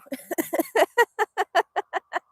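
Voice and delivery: long, high run of giggles; amused laughter. Real, not acted. Laughs at live going to others.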